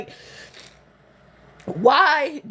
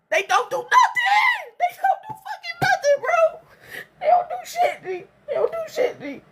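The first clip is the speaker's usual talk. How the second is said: much higher wailing cry; 14 semitones higher than usual. Real, not acted. Crying out that her family does nothing, voice breaking. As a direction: wail it, voice breaking into tears.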